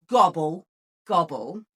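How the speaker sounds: ordinary delivery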